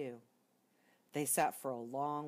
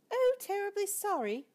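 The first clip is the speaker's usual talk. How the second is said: very high mouse apology; murmured; 6 dB louder than usual. Acted. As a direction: apologise sweetly. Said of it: "murmured the mouse".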